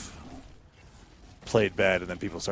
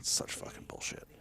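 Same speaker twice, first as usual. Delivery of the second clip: soft unvoiced grumble; whispered frustrated swearing; whispered curse; 9 dB quieter than usual. Real, not acted. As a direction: hiss it under your breath. Muttering after loss.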